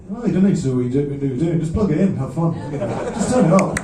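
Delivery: lively mock-casual urging; carefree encouragement. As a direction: wave away the worry, punchy and loud. Retelling Edwyn's carefree encouragement.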